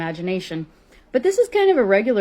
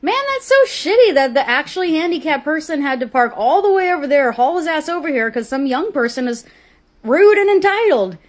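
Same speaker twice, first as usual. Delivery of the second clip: loud, high, pointed callout; shouting across the parking lot at the woman; 9 semitones higher, 8 dB louder than usual. Retold or imitated. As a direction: announce it loud for the whole lot to hear. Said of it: "very loudly".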